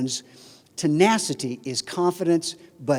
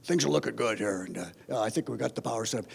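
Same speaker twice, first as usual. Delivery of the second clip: lower, softer, self-assured student; deep resonant voice; 6 semitones lower, 6 dB quieter than usual. Retold or imitated. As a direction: play the big confident student, smooth and low. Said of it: "deep, well-modulated resonant voice".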